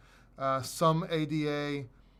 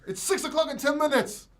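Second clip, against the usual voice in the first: high, raised-voice correction; shouting; 12 semitones higher, 4 dB louder than usual. Real, not acted. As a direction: keep shouting as you correct them. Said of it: "sorry for making you shout".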